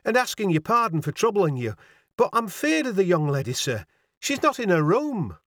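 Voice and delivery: worried landlady voice; flustered Yorkshire landlady. A narrator's reading. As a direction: fret in a northern dialect.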